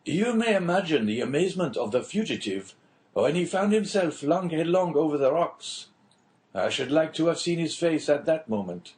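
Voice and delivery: a hint of the chatty official; lightly voiced prison inspector. Inspector telling the Englishman a prison tale, lightly voiced.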